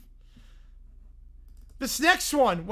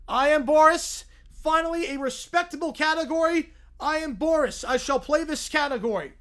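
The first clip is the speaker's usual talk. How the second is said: Boris the stern dad; 7 semitones higher, 6 dB louder than usual. Acted.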